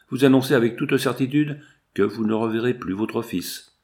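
ordinary delivery

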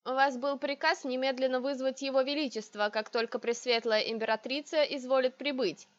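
usual speaking voice

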